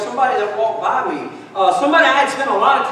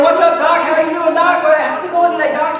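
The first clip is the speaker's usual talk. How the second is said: imitating a chummy hello, pitched up; mock breezy greeting; 4 semitones higher than usual. Minister friend greeting people as Doc, mock chummy. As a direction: rattle off hearty mock greetings.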